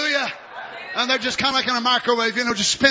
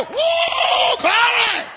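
high, loud whoop of glory; 13 semitones higher, 4 dB louder, much slower than usual. Acted. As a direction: whoop it out with joy. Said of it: "The dirt might go".

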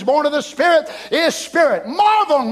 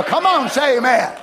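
usual speaking voice

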